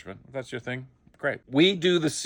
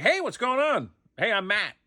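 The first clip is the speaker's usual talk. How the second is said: much higher, eager and friendly; bright eager intro, pitched way up; overly chipper hello; 10 semitones higher than usual. Retold or imitated. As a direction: greet her like a puppy meeting a stranger. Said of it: "I'm like".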